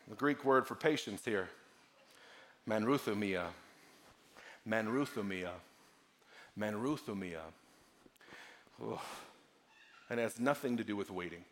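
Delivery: low, soft teaching with long pauses; hushed preaching. Real, not acted. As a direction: land each phrase gently and gravely. Hushed teaching on the Greek word.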